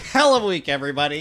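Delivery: laughing. Acted. Laughing repeat of greeting.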